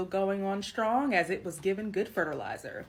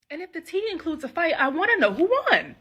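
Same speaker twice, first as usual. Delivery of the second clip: excited; 9 semitones higher, 7 dB louder, faster than usual. Real, not acted. Eager about family fights.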